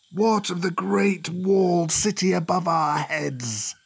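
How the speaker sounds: drawn-out serpent voice. Acted. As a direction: question him like an old serpent, stretching the words. Cobra questions the visitor.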